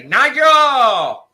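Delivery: high, loud sung-out name call; imitating the guy. Imitating the Dunkin' worker calling out a name. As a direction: belt the name like the quirky manager. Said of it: "that guy that would say".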